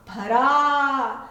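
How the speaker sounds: slow, stretched blending of the syllables; drawn-out word. Shown as a demonstration. Teacher to children, slowly stretching भरा. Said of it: "र पर लगी आ की मात्रा रा".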